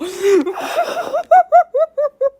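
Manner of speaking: shrieking laugh, high; high squealing laugh. Real, not acted. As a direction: burst into high-pitched laughter. Reaction to creepy voice.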